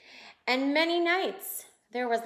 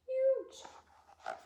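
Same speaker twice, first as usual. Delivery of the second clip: awed huge; 12 semitones higher, 6 dB quieter than usual. Pretend. Showing kids the huge waves.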